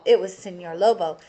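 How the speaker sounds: usual speaking voice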